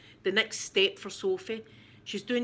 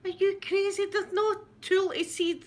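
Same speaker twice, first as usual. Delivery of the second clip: higher mock voice of the others, mildly imitated; mocking disbelief; 12 semitones higher than usual. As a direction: imitate everyone scoffing at her, lightly. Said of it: "everybody was like".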